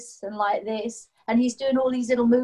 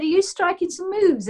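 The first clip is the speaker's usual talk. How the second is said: playful teasing; 8 semitones higher, 3 dB louder than usual. Retold or imitated. Teasing boy dancing in bed.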